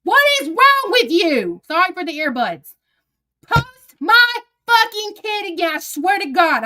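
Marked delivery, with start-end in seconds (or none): 0.0-1.6 high, loud, slow disbelieving yell; furious. Real, not acted. Mother yelling at Anna online, loud and outraged. Angry at Anna over post. 3.5-6.6 high, loud warning; furious threat. Real, not acted. Mother warning Anna not to post her kid, furious.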